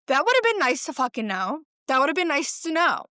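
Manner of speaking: mock outrage at being told too late; exasperated. Daughter reacting to mom's late period explanation, exasperated.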